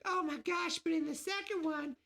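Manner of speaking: hammed-up squeaky fan, rushed at the end; mocking fans. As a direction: mimic a fussy nitpicking fan. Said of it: "everyone might be like".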